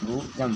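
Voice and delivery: बूजम with long oo, then "jam"; correct pronunciation. Shown as a demonstration. Teacher showing students how to read bosom, बूजम said "boo-jum". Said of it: "इसको बूजम पढ़ते हैं".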